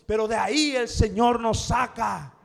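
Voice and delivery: a big, loud rising declaration; preaching at full force. Real, not acted. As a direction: proclaim it loud, God lifts us out. Preacher proclaims God lifts us.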